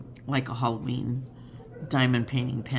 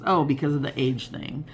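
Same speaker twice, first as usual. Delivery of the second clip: normal talking voice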